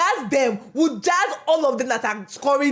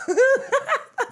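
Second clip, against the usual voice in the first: high-pitched laugh; 13 semitones higher than usual. Real, not acted.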